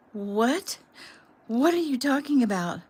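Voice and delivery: frantic questions climbing toward hysteria; edge of hysteria. A narrator's reading. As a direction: panic building with every question. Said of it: "her voice on the edge of hysteria".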